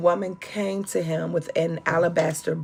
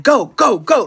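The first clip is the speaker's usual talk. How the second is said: urgent go; 3 semitones higher, 7 dB louder than usual. Retold or imitated. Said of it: "Derek is like".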